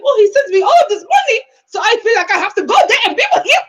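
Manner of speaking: high, loud mimicked whine; squealed, exaggerated mock-quote of her; imitating a daughter. Daughter telling her dad about his money, high mocking imitation. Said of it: "but she said it to her dad".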